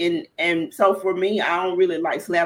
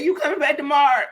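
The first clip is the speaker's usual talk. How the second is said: higher, quick, hopeful question in a hint of the lady's voice; lightly voiced sales lady; 8 semitones higher, faster than usual. Guest voicing the sales lady asking her back, lightly acted. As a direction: ask like a hopeful recruiter.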